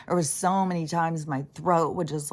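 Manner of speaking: ordinary delivery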